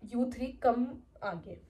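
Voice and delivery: slow, drawn-out command; ma'am calling the three culprits forward, slow and stern; stern teacher. Retold or imitated. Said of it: "उन्होंने बोला".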